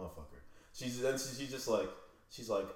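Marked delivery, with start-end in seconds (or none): 0.1-0.4 low, hushed curse under the breath; muttered curse. Retold or imitated. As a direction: mutter it to yourself, fed up. Retold private exasperation at her demand.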